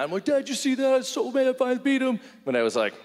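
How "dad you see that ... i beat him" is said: very high, gushing boast; acted breakneck pride, pitched way up; excited son. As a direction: squeal it to Dad like a thrilled kid. Proud son boasting to dad.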